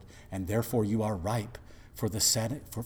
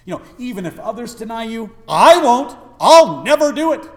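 loud, high, fast bragging promise; boastful Peter; 11 semitones higher, 18 dB louder than usual. Retold or imitated. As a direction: Peter swearing he'll never fail, shouted high. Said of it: "Remember what Peter said?".

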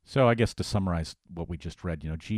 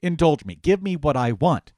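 higher and louder, insistent demand; old Adam demanding; 7 semitones higher, 7 dB louder than usual. Retold or imitated. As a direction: push the demand harder like a spoiled sinner. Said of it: "the old Adam says to the pastor".

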